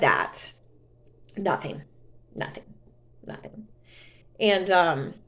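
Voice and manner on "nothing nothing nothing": each nothing softer until barely there; fading out. Real, not acted. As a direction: let it trail off to nothing. Repeating word, fading to silence.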